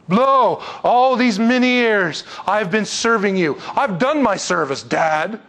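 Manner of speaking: high, loud, drawn-out grievance; disrespectful complaint. Acted. Older son complains to his dad with no respect, aggrieved. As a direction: protest as the wronged older son. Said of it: "There's no respect shown".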